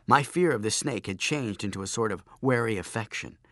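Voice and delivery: plain reading voice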